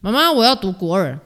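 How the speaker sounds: re-enacted loud call to mum; calling out loudly. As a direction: shout a sudden decision to mum. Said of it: "我就大声地跟我妈说".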